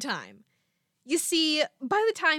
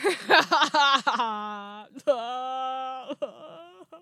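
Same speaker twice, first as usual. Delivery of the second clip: helpless laughter. Real, not acted. Podcaster cracking up at her own botched line, helpless laughter. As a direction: burst out laughing, drawn out till it wails.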